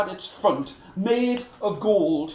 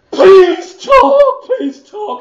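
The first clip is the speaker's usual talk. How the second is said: very high, loud pleading, then falling off; desperate begging; 13 semitones higher, 14 dB louder, slower than usual. Acted. The soldier desperately begs the horse, high and loud. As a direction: beg in panic.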